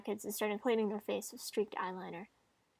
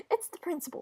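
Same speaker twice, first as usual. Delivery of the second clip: "It's the principal" pitched up, falling at the end; higher-voiced insistence at normal volume; high-pitched protest; 7 semitones higher, faster than usual. Acted protest, said twice differently.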